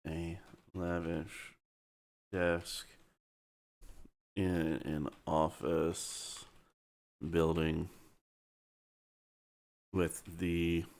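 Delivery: measured, halting word-by-word dictation; slow dictation. Real, not acted. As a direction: dictate slowly, pausing between phrases. Dictates a scene description slowly.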